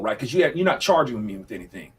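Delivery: ordinary talk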